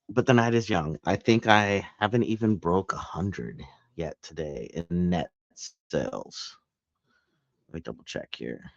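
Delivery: ordinary talk, nothing marked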